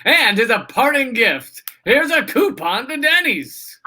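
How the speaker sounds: high, stretched announcer singsong; hammed-up host presenting a prize; game show voice guy. Host handing loser a parting gift, game show voice. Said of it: "if the game show voice guy was like".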